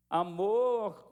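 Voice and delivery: ordinary talk, nothing marked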